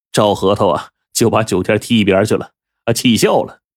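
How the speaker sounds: plain reading voice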